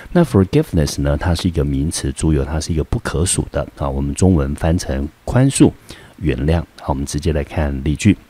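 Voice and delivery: usual speaking voice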